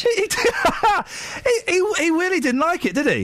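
words squeezed out between high giggles; laughing through it. Real, not acted. Host cracking up about a caller who hated the show, real laughter. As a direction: try to talk while cracking up.

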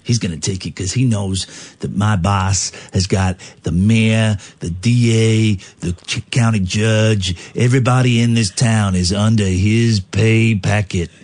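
slow, menacing mobster put-on; gangster. Henchman telling police who his boss owns, smug mock menace. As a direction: brag of your boss's power like a movie gangster.

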